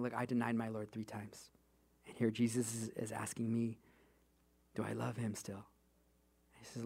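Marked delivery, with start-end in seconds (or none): 0.0-5.7 hushed, low-key sorrow; sad. Acted. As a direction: quietly voice a humbled man's regret. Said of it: "Peter now is sad".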